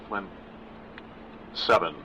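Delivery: usual speaking voice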